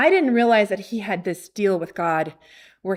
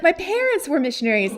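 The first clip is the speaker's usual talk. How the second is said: high, quick, eager revelation; excited sharing; 7 semitones higher, faster than usual. Retold or imitated. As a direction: imitate a thrilled man sharing his connection. Acts seller's excited disclosure.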